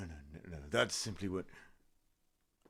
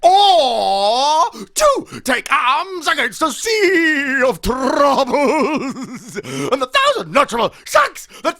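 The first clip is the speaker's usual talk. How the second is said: hammed-up Shakespeare; 10 semitones higher, 4 dB louder than usual. Shown as a demonstration. Old actor resumes Hamlet, loud and overwrought. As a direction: wail it like a grand tragedian. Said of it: "Allow me to demonstrate".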